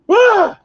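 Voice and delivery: sharp scream of alarm; fright scream. Real, not acted. Gamer screaming at a charging cougar. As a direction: scream when it lunges.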